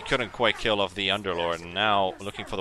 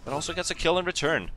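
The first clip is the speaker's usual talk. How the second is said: raised, loud esports commentary; excited play-by-play; 9 semitones higher, 7 dB louder than usual. Real, not acted. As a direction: call the kill as it happens, pitched up and loud. Live play-by-play at a kill.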